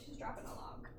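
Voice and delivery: hushed whisper over the pet; breathy murmur, barely there; whispering so as not to disturb the dog. Real, not acted. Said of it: "whispering while pet poops".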